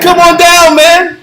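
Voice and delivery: high, loud retold taunt; mock-eager invitation; taunting dare. Retells daring the scam caller.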